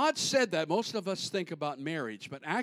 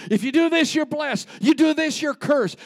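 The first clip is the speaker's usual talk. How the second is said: loud, higher, call each line across the valley; hills calling back and forth; 9 semitones higher, 9 dB louder than usual. Retold or imitated. Preacher acting out two hills shouting blessings and curses. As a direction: shout like one hill answering the other. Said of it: "they would talk back to each other".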